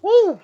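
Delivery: high, loud startled whoop; real jump-scare yelp. Real, not acted. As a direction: yelp out in sudden fright. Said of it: "That one scared me".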